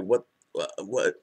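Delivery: ordinary talk, nothing marked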